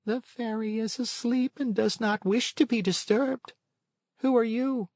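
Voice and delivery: a small odd creature, unhurried; snail. A narrator's reading. Snail speaking.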